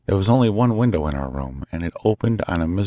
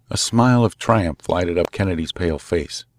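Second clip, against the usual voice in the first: ordinary delivery, nothing marked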